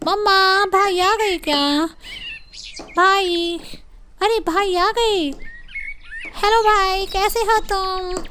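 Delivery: put-on a girl delighted her brother is home; excited sister. A narrator's reading. (बहन की उत्साहित ऊँची आवाज़)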